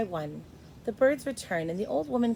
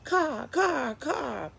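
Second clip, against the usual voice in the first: high, cawing crow cry; 6 semitones higher, much slower than usual. A narrator's reading. Crow screaming into Edward's ear, cawing. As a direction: screech it like a crow. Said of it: "screamed a dark message".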